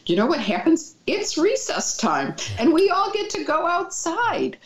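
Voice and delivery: imitating a kid's high-pitched announcement; excited kid. Retelling a kid's recess news about a crazy household, excited. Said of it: "another kid said to me".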